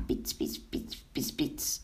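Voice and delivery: whispered spitting sizzle; sizzling butter sounds. A narrator's reading. Narrator imitates butter and batter frying, whispered sizzle. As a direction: imitate batter sputtering in the pan. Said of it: "hoorde je toen weer".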